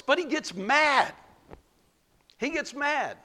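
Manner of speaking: mad pitched high, falling hard; preacher stressing that Jonah gets mad. Acted.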